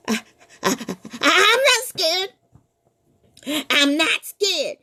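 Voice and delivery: high panicky bluster; terrified boy insisting he is fine; scared stammer. Acted.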